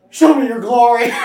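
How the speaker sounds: a loud cry of longing; loud, passionate cry of Moses; desperate plea. Acted. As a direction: cry out as if dying to see God.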